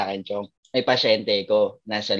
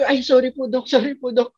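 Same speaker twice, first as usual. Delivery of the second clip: higher, sheepish apology; apologetic relative; 11 semitones higher than usual. Retold or imitated. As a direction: play the relative saying sorry in embarrassment. Relative apologizing in retold story. (nahihiyang paghingi ng tawad)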